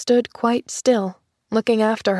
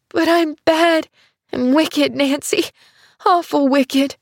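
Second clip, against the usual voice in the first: sobbing; 6 semitones higher than usual. A narrator's reading. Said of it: "she sobbed".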